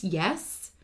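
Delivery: ordinary talk, nothing marked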